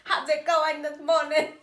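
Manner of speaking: hammed-up British accent, high and loud; loud mock-British greeting, laughing through it. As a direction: do an over-the-top British accent. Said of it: "that's my British accent".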